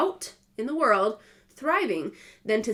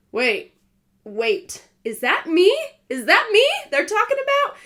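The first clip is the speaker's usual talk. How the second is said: rising, louder, high-pitched panic; wait, wait, it's me?; alarmed disbelief; 10 semitones higher, 7 dB louder than usual. Retold or imitated. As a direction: realize in shock it's about you. Panicked realization at the hospital.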